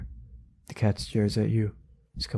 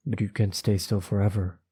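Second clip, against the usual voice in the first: ordinary delivery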